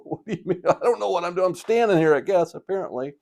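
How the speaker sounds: high-pitched, loud mock confusion; acted bewilderment. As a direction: replay a baffled, just-woken protest. Groggy sleepwalker answers wife, confused.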